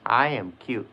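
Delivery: usual speaking voice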